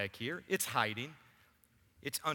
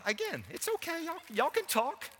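much higher, lightly teasing call; playful high reassurance; 14 semitones higher than usual. Real, not acted. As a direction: reassure the room in a high sing-song. Calling out from behind cart.

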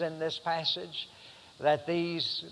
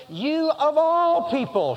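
high, loud, slow outcry on "You, of all people"; anguished cry as Paul; 10 semitones higher, 4 dB louder, slower than usual. Acted. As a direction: cry it out in disbelief. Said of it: "And Paul is saying".

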